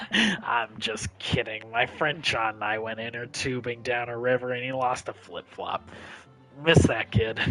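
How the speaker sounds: low, deadpan punchline delivery; low-pitched storyteller undercutting his own tale; army veteran storyteller. Acted. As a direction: admit the joke, still in the veteran's low voice. Same character drops the punchline.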